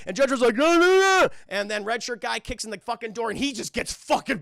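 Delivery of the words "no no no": loud, high, garbled warning bellow; Sylvester Stallone. Retold or imitated. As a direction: holler it in an action-hero slur. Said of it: "I'll translate Stallone's".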